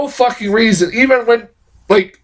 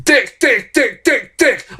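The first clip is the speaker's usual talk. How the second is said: high, punched repeats in a string; insistent repeating; 7 semitones higher, slower than usual. Retold or imitated. Reviewer imitating Dastardly repeating his name, insistent. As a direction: fire off the same word over and over, imitating. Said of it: "He keeps saying".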